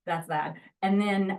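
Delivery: ordinary delivery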